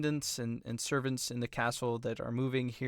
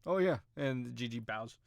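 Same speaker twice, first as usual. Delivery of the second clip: usual speaking voice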